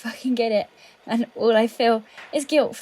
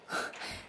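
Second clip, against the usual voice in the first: shaky sigh; 13 dB quieter than usual. Acted. Breaking down mid-accusation.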